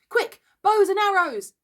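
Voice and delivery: high, loud call to arms; urgent cry. A narrator's reading. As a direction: shout orders like boys in a rush. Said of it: "cried the simple boys".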